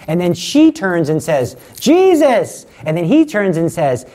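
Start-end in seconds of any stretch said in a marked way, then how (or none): 1.8-2.6 high, loud call of Jesus. Retold or imitated. Mary turning to call her son, high and loud. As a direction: call your son across the room.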